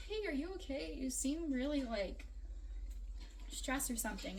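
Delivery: softly asking if she's okay; gentle concern. Retold or imitated. A light imitation of friend checking on a stressed friend. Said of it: "I didn't even yell at her".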